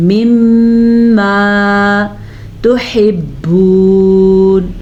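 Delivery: slow melodic tajweed chant; Quran recitation. Shown as a demonstration. Teacher demonstrates the verse for students, slow chant. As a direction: draw each word out in a chant.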